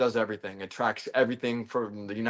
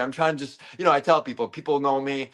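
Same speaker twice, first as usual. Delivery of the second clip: normal talking voice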